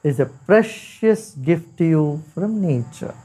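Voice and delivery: unhurried meditation guide with long pauses; guided meditation. Acted. Teacher guiding listeners through body, breath and mind, guided meditation. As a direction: lead them gently inward, leaving space after each phrase.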